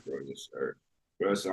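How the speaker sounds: normal talking voice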